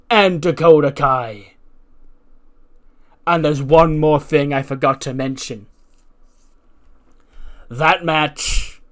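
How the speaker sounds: loud, drawn-out wrestling promo; authority figure's announcement. Acted. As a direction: announce the big match slowly, hitting each name. Acts out the boss's match announcement.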